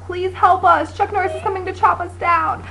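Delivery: a hint of a panicked little apple. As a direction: call out for help, playfully. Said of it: "he yells a little bit more".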